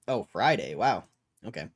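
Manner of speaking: usual speaking voice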